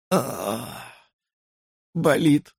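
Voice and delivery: pained groan through an unmoving mouth; lipless mumble. A narrator's reading. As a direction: moan it without using your lips. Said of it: "не двигая безгубым и шершавым отверстием". (стон, не шевеля губами)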